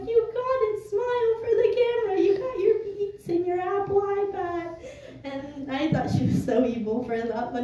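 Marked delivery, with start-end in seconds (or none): 0.1-4.8 high, loud playful teasing voice; teasing mom. Retold or imitated. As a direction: tease like a laughing mom. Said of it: "My mom's laughing. She's like".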